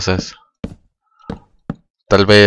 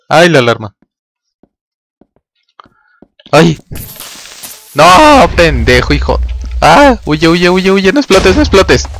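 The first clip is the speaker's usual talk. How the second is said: high, loud panicked yelling; panicked shrieks; 8 semitones higher, 5 dB louder than usual. Real, not acted. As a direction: scream at the creeper to run. Pánico ante un creeper. (gritos agudos de pánico ante el creeper)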